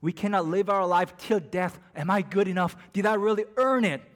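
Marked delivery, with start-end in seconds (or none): 2.0-4.0 higher, louder, pleading questions; anxious plea. Acted. A believer cries out, pleading for validation. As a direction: cry out the doubt at full force. Preacher voices anxious self-doubt.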